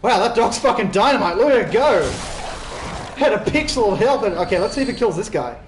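loud, high cheering on; root for the dog; excited cheering. Real, not acted.

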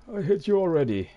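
high, loud shocked squeal. Acted. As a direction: squeal it out in disbelief. Shocked at character face. (schockiertes Quieken)

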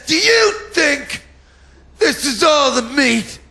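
high shouting market trader; hammed-up market seller yell; meat van hawker.

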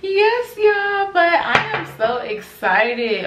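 excited. Real, not acted. Sing-song excitement.

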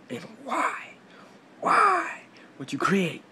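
high, hushed incredulous whisper; whispered rant. Real, not acted. Teen ranting to his viewers, suddenly whispering. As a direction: drop to a whisper mid-rant.

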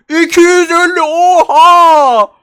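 loud, high amazed exclamation; high, loud amazed gasp over a new sword; excited shout. Real, not acted. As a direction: shout in delight at a great drop. Gets powerful sword.

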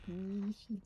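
ordinary talk

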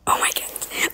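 whispered under the breath. Real, not acted. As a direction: breathe it out, voiceless. Overwhelmed by the riddle.

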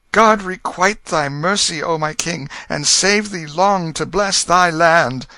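high, loud burst of gratitude; glad cry. A narrator's reading. Tom on his knees thanking the king for mercy, glad cry. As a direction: cry out joyfully, high and full. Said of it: "with a glad cry".

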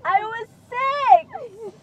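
high, slow, drawn-out protest; whiny insistence; defensive wail. Real, not acted. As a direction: stretch it out in exasperation. Defends herself against teasing.

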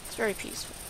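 very peaceful, breathed out unvoiced; whispered. Shown as a demonstration. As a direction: whisper it softly and calmly. Repeats line, second whispered.

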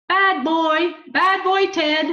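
high, loud squawking bird voice; parakeet voice. Acted. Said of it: "I don't know whether I sound like a parakeet".

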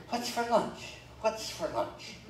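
high, put-on voice of a hungry minister; mock minister. Preacher mimicking a friend asking about lunch, in a mock high voice. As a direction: play the hungry friend, nagging. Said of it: "I'm just talking for him".

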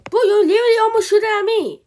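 fast, high-pitched complaint; agitated protest. Acted. Protest at being shot at.

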